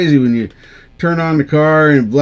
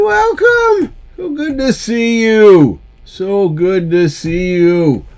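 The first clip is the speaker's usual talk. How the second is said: long, stretched-out words in a raised pitch; drawn-out greeting; 7 semitones higher, slower than usual. Real, not acted. Streamer greets viewers dropping by, drawn out. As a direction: stretch the welcome right out, pitched up.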